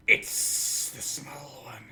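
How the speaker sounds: slow, whispered scheming villain; sinister villain voice. Acted. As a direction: hiss it out like a plotting henchman. Villain reptiles voiced in cutscene.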